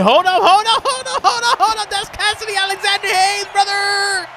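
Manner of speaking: high, laughing hold up as a surprise unfolds; excited laughing shout. Real, not acted. Streamer recognizing a surprise tag partner, high laughing excitement. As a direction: lose it with delight at who just showed up. Spots a surprise wrestler entrance.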